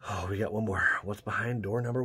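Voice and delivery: normal talking voice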